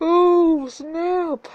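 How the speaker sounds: high, loud laughing ooh snap; laughing squeal. Real, not acted. Reader reacts laughing.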